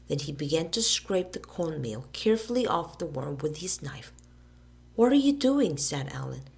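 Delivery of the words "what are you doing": high, loud question; alarmed. A narrator's reading. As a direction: ask in alarm. Alan alarmed.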